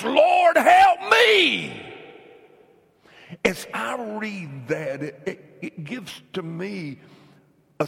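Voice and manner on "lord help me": loud, high preacher's cry, the last words held long; impassioned shouted plea. Acted. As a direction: cry it out at full force. Preacher cries out the woman's plea.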